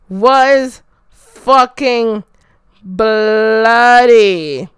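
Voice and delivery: slow, loud stress on "bloody"; heavy emphasis. Real, not acted. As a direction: draw out "bloody" after measured, separated words. Emphatic verdict on the match.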